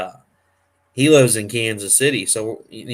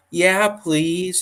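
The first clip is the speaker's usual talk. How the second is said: high imitation of a child; eager little kid; 8 semitones higher than usual. Retelling the boy accepting Ryan's offer, eager high voice. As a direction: plead eagerly in a small kid's voice. Excited child answering Ryan, retold.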